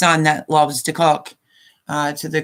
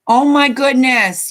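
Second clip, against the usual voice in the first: thrilled exclamation, much higher and louder; delighted gasp; 7 semitones higher, 5 dB louder than usual. Real, not acted. Grandma sees grandson joined, delighted exclaim. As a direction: burst out with joy as your grandson appears. Grandson joins the live chat.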